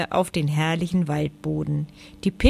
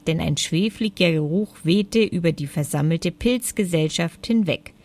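plain reading voice

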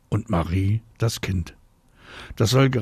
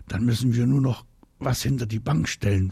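ordinary delivery

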